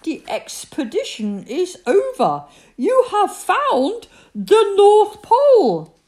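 slow, high, loud grand announcement; solemn proclamation. A narrator's reading. Christopher Robin declares the North Pole found, solemnly. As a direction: proclaim it with great ceremony.

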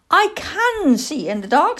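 high, loud little-owl boast; young owl Plop, indignant. Acted. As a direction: owlet insisting on his powers. Owlet boasting to old lady.

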